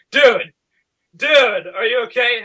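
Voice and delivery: high, loud worried calling; mock alarm. Onlooker checking on friend laughing at a wall, mock alarm.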